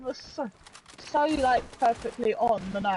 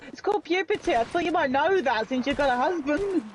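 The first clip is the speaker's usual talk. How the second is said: much higher, sassy retort; pretend telling-off; 7 semitones higher than usual. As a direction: act out scolding a clueless mum. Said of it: "I would have gone".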